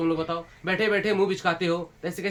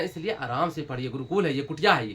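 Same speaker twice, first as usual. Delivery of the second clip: reassuring; 8 semitones lower, 5 dB quieter than usual. Real, not acted. Teacher reassures anxious students.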